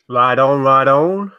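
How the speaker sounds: a light send-up of a friend's catchphrase; imitating Chad's accent. Teasing Chad by copying his accent, mock drawl. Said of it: "I've got to do a Chad's accent".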